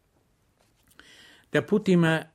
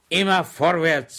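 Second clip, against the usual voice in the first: a higher, louder brave soldier; Schweig cheering himself on; 7 semitones higher, 7 dB louder than usual. Acted. Schweig urging himself on, higher and louder. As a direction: spur yourself forward. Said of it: "sagte sich der brave Soldat Schweig".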